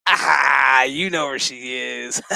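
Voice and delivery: loud cackling cry easing off; mock outburst. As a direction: burst out loudly, then settle into teasing. Mock outburst voicing character's reply, then laughs.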